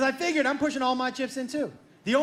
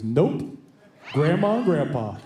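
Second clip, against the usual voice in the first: low, louder, flat refusal; brother's low refusal; 9 semitones lower, 3 dB louder, slower than usual. Retold or imitated. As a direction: shut him down, unmoved.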